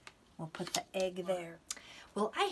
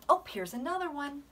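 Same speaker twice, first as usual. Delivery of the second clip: ordinary talk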